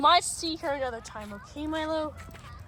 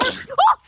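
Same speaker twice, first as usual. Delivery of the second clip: sharp gasp; 9 semitones higher, 6 dB louder than usual. Real, not acted. Startled by dog's loud sneeze.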